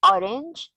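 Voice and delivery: ORANGE stressed on the first syllable, high and rising. Shown as a demonstration. Teacher repeating the played example, ORANGE stressed at the start. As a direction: lift ORANGE, weight on its opening syllable. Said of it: "in orange is on the first syllable".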